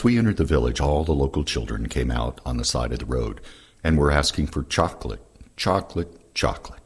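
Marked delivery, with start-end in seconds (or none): none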